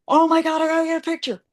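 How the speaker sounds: high, gushing mock fan voice; imitated excited fan. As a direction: gush like a starstruck fan, pitched high. Voicing a delighted cosplay fan.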